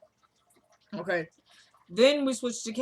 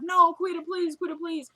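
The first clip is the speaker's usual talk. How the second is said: desperate pleading; 6 semitones higher than usual. Retold or imitated. Woman being taken away begging boss, mock-desperate. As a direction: retell a helpless plea up high.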